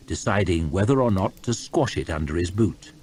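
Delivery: plain reading voice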